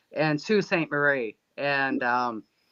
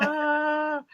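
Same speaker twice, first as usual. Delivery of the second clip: long high "ah"; mock summoning call to the sky; imitated alien-calling tone; 10 semitones higher than usual. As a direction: hold a high, sustained "ah".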